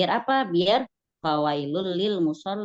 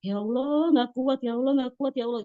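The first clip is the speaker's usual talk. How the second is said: mock crying plea; 7 semitones higher than usual. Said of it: "mendingan nangis".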